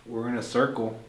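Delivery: ordinary delivery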